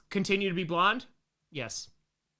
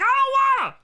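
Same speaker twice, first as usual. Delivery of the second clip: put-on high, loud toddler wail; mock child's protest; 8 dB louder than usual. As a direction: refuse like a protesting little kid. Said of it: "I was like".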